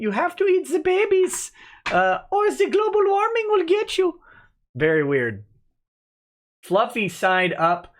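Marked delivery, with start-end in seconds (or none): 0.0-4.2 much higher mock voice of a zealous activist; mocking the lady. Streamer imitates a lady at an AOC event, much higher mocking voice. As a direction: pitch it way up, parodying her earnestness. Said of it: "the lady saying".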